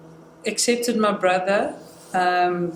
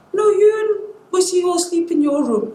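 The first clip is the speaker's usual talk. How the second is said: mom's reply pitched high and louder; imitating mom; 10 semitones higher, 4 dB louder than usual. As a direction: play mom, high-pitched and matter-of-fact. Mom's reply voiced in the story.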